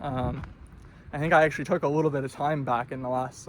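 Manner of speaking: ordinary talk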